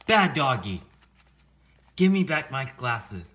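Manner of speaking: loud, high burst of scolding; angry scolding. Acted. Mayor to a dog who took the glasses, angry scolding. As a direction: scold a naughty dog at full volume.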